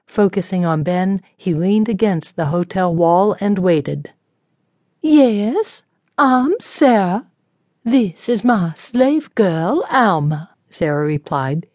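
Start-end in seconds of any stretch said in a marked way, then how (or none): 5.0-10.5 higher, slow, haughty and impatient; irritated and impatient. A narrator's reading. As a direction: talk down to her, annoyed. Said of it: "irritated and impatient".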